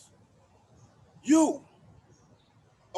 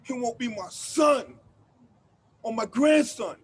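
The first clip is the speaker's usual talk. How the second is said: loud, hard accusation building up; rising anger; 5 dB louder than usual. Acted. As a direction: hammer the fear home, getting louder. Angry monologue about police.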